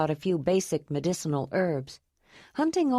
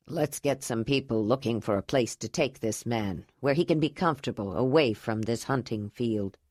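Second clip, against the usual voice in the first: lower, measured elder giving orders; older leader's voice; 7 semitones lower than usual. Acted. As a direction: take charge calmly.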